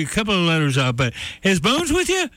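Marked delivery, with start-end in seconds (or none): 1.4-2.3 high-pitched imitated call, no real volume; mock yell. Old friend calling into Reagan's elevator, mock yell. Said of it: "he yells into the elevator".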